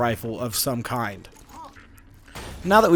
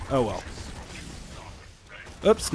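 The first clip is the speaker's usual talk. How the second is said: ordinary talk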